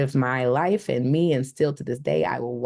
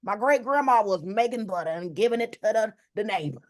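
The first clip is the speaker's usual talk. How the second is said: raised, lightly country Southern voice; mock Southern country accent; 6 semitones higher than usual. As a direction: lay on the down-home Southern talk. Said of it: "Southern type of accent".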